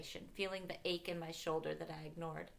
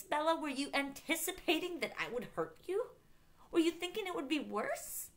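higher-pitched, incredulous angry questioning; livid; 5 semitones higher than usual. A narrator's reading. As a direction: fire outraged questions at her. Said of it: "his expression was suddenly so livid".

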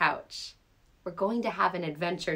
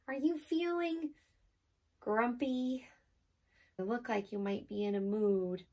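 gentle; 6 dB quieter than usual. Acted. Softly coaxing the cat.

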